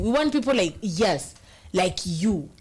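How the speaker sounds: ordinary talk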